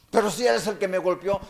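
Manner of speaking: mock objection. Offended person's objection, acted.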